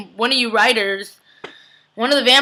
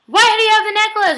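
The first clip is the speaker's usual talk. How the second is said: much higher, louder accusing question; angry demand; 10 semitones higher, 4 dB louder than usual. Retold or imitated. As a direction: demand it like a furious vampire. Rebecca confronting Caroline.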